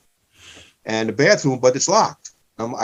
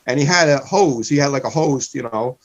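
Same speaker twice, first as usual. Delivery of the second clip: ordinary talk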